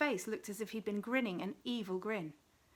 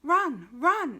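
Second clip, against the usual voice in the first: very loud, high shout of warning; distant voice crying out, loud and high; urgent cry; 6 semitones higher, 12 dB louder than usual. A narrator's reading. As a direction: yell it so they flee. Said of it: "it shouted again".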